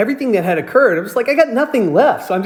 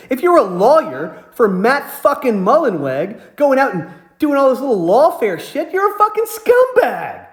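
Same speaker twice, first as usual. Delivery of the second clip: scornful retold tirade, drawing out the insults; retold furious rant; 3 semitones higher than usual. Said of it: "I'm just furious mad".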